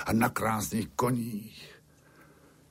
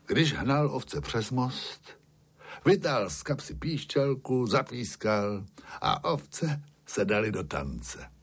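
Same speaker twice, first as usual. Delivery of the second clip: plain reading voice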